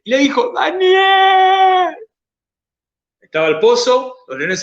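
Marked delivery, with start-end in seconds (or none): 0.5-1.9 long, high, sorrowful call of the name; a king calling mournfully into the pit; sad call. Retold or imitated. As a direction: call out Daniel with grief, drawn out. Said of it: "llamó a Daniel con voz triste".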